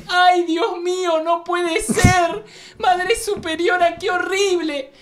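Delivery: high, slow falsetto nun; a young nun calls the Mother Superior over the package; shocked nun. Acted. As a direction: squeal like a horrified little nun.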